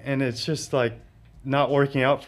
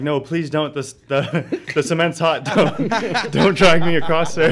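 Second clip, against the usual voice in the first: laughing plea; 4 semitones higher, 7 dB louder than usual. Retold or imitated. Begging officers not to drag him.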